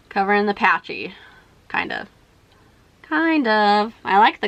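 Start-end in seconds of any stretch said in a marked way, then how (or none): 1.7-2.1 very low, quiet unconvinced aside; doubtful mutter. Real, not acted. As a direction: mumble the hedge under your breath. Doubtful hedge on her makeup.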